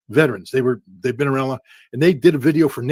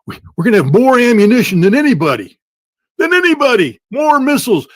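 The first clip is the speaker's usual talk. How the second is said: high, loud bragging imitation; mocking Trump's boast; 9 semitones higher, 9 dB louder than usual. Speaker mocks Trump's speech, brash boastful imitation. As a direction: ham up the boastful promise, much higher and louder. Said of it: "the speech he gave the other day".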